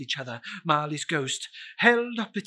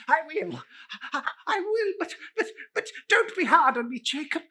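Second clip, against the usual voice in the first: high, fast, stammering plea; terrified old man stuttering; frightened pleading; 10 semitones higher, faster than usual. Acted. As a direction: beg the ghost in a panic. Scrooge pleads with ghost.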